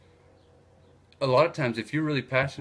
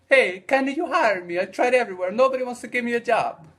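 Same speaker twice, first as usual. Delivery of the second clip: much higher and louder, a put-on Italian immigrant voice; Italian guy voice; 12 semitones higher, 6 dB louder than usual. Italian guy begging the antique store owner for work, high and loud put-on voice. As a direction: play the jobless Italian guy pleading loudly. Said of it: "and he tells the guy".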